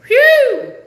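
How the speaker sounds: very high, loud "phew"; exaggerated heat whoop. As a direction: let out a piercingly high whew at the heat. Reacting to the heat.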